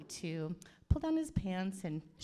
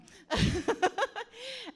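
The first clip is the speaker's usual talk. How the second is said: short, high burst of laughter; real giggle; 6 dB louder than usual. Real, not acted. Auntie teases groom and his dad, short high laugh. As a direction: let out a quick high cackle. Aunt laughs at own joke.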